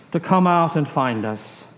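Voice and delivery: ordinary delivery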